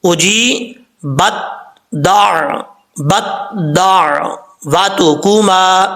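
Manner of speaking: slow اجی بت دا with تا sounded; correct tajweed. Shown as a demonstration. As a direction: sound the تا, then join it to دال. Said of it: "یہ پہلے تا پڑھا جا رہا ہے". (درست: تا کی آواز کے ساتھ آہستہ تلاوت)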